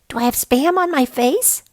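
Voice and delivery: Max's voice. A narrator's reading. Max voiced in story.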